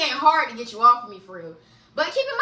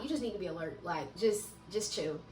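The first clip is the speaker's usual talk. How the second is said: soft, low inner voice telling her to stay alert; hushed, like a voice in her head; 9 semitones lower, 12 dB quieter than usual. Retold or imitated. To herself on the drive, a soft low inner voice. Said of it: "something telling me".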